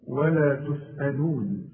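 "ولا تسألون" with every letter thin and light, slow recitation. Shown as a demonstration. Teacher reciting a Quran verse, "ولا تسألون" light and slow. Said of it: "ولا تسألون كلها برضه مرققة".

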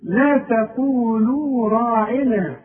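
slow recitation of لا تقولوا راعنا; measured chanted لا تقولوا راعنا; tajweed recitation. Shown as a demonstration. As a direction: recite slowly, stretching the long vowels. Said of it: "التاء احنا اتفقنا".

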